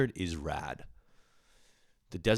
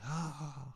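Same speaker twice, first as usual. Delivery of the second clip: high, soft, open "ah"; imitation of a delighted gasp; imitated laughing gasp; 9 semitones higher, 9 dB quieter than usual. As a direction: mimic her high gasp of glee. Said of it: "And she was like".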